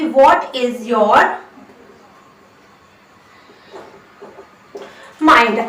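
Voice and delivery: very slow, word by word dictation; slow model sentence. Shown as a demonstration. Teacher dictates the English sentence very slowly. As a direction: spell it out slowly for the class. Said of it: "भी बोल सकते हैं कि".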